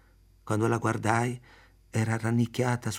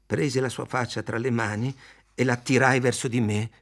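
ordinary delivery, nothing marked

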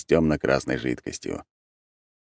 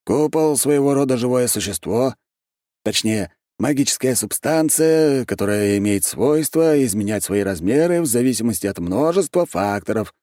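higher-pitched, emphatic explaining; touchy archmage; 6 semitones higher than usual. A narrator's reading. Архимаг explains the dome's nature, mildly irritated. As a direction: lecture with a touch of impatience.

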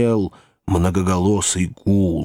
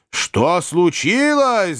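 high, drawn-out bellow; roaring; 9 semitones higher, much slower than usual. A narrator's reading. Master of the house demanding news, roaring. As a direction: roar the question, slow and high. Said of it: "заревел хозяин дома".

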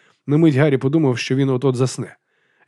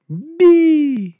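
piercing high, held cry; loud, very high-pitched shriek; screamed syllable; 5 dB louder than usual. A narrator's reading. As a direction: scream it as one long note. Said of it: "яка кричала другий склад".

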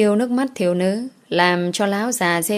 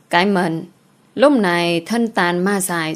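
ordinary delivery, nothing marked

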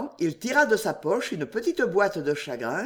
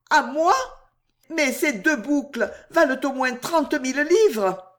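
high, loud gasp of disbelief; can't believe the gift is hers; astonished; 8 semitones higher, 3 dB louder than usual. Acted. Juliette, stunned at the diamonds, asks Jacques in high disbelief.

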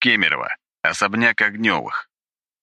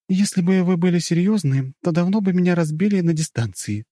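modest; 13 semitones higher than usual. A narrator's reading. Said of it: "поскромничала Юля".

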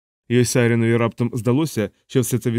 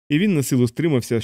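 ordinary delivery

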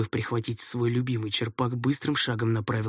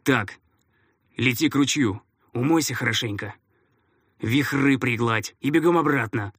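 brisk order; 2 semitones higher, 5 dB louder than usual. A narrator's reading. Narrator ordering boy off.

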